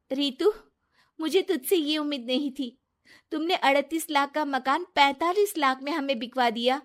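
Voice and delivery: hurt angry reproach. Acted. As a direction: scold her friend, wounded and loud.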